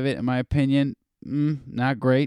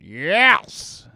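lightly voiced hyped comic, bursts of loud cheers; a hint of a hyper motivational shouter; Brody Stephen impression; 5 dB louder, slower than usual. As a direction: pump up the room like a hyped-up comic. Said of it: "it always reminds me of Brody Stephen".

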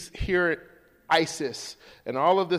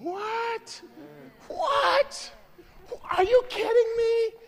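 astonished outburst; much slower than usual. Real, not acted. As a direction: shout your amazement at the verse. Preacher reacts in amazement.

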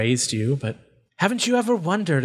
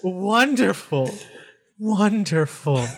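slow, higher, savoring creature voice; trembling delight; 5 semitones higher, slower than usual. Acted. Deranged voice enjoys seeing pain, trembling with delight. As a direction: draw it out in twisted pleasure. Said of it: "you can feel this voice trembling".

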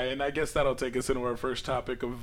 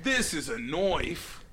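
put-on Aussie accent; 3 dB quieter, much slower than usual. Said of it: "Australian accent".

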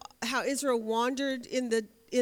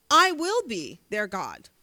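"I will be their God" with I hit hard, louder and slow; emphatic; 2 semitones lower, 7 dB louder, slower than usual. Shown as a demonstration. Teacher stressing God's promise to the class, emphatic and slow. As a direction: land hard on "I", slowing down. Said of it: "It's almost an emphatic statement".

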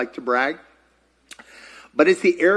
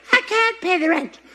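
high helpless damsel voice; damsel in distress; 12 semitones higher than usual. Acted. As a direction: wail it like a melodrama heroine. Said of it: "This is a hair bow".

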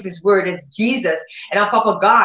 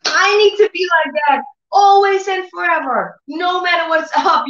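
excited resolve; 9 semitones higher than usual. Retold or imitated. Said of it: "I said".

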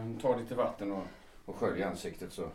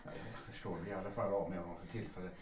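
hushed; 3 semitones lower, 10 dB quieter than usual. Acted. Lawyer comforting beaten woman.

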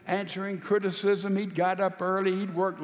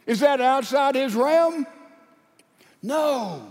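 loud, raised preacher's delivery; full-force preaching; 4 semitones higher, 8 dB louder than usual. Real, not acted. Preacher challenges congregation on God's power, full-force preaching. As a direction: preach it hard, voice lifted on the questions.

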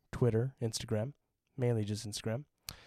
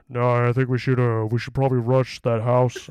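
imitating a louder faked grown-up voice; voice changer; 10 dB louder than usual. Kid on a game lobby faking a grown-up voice. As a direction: put on a bigger voice to hide being a kid. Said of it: "make it deeper".

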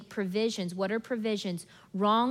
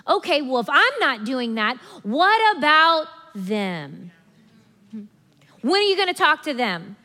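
mock indignant complaint; 3 semitones higher, 6 dB louder than usual. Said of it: "all of a sudden we're like".

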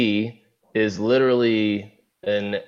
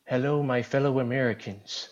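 put-on old politician voice; 3 semitones higher, 6 dB quieter than usual. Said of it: "pretty good. That's not bad".

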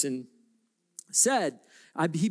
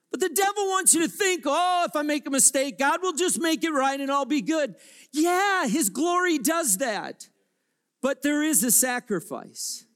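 high, loud, driving sermon; full-force preaching; 10 semitones higher, 3 dB louder than usual. Real, not acted. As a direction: preach it out over the whole room.

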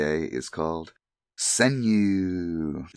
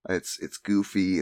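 ordinary delivery

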